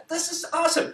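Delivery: very high, replayed delight; giddy high-pitched enthusiasm. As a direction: exclaim it high, thrilled. Acts out own delighted reaction.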